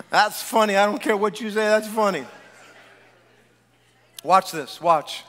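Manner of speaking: ordinary delivery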